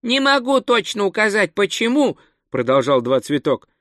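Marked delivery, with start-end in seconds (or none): 0.0-2.2 высокий голос наивного туриста; говори заметно выше, простодушно и старательно; простодушный турист. A narrator's reading. (высоким голосом наивного туриста)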